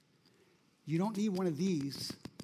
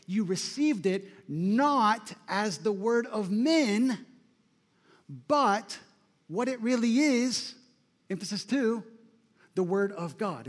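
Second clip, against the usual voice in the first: emphatic scripture quote; 5 dB louder than usual. Real, not acted. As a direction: proclaim the contrast.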